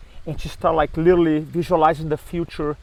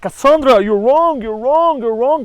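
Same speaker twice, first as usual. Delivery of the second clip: taunting; 7 semitones higher than usual. Retold or imitated. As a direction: gloat at her, repeating it. Everybody taunts Cassandra.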